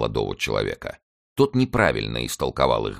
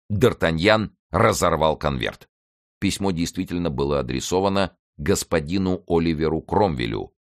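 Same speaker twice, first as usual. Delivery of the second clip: ordinary delivery, nothing marked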